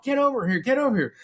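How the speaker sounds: high-pitched frantic urging, imitated; freaking out. Manager and ghost hunter beckon him, freaking out. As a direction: mimic someone urgently calling him over. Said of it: "like, freaking out".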